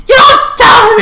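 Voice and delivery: high, squeaky protest; mock indignation. Mock indignation about nickname.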